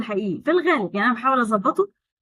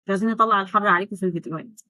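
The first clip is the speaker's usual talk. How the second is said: ordinary delivery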